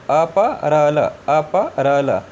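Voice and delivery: अपरल drawn out slowly as a-pa-ral; slow vowelless reading. Shown as a demonstration. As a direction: stretch अपरल syllable by syllable. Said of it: "आई को हटाना है". (धीरे-धीरे अपरल पढ़ना)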